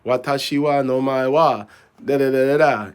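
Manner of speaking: hammed-up clueless learner; mock foreigner rattling off textbook phrases; mock beginner Japanese. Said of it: "sounds very textbooky".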